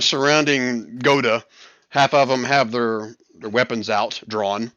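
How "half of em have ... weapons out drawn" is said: ordinary talk, nothing marked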